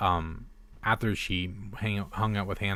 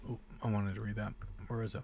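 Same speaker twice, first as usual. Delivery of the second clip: wired and fast; 12 dB quieter, faster than usual. Real, not acted. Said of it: "I'm wired right now".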